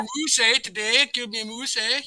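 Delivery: put-on Minnesota accent. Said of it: "people saying".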